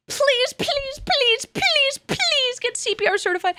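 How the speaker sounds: high, begging repeated please; earnest high plea to viewers; high pleading please. Real, not acted. Pleading to get certified.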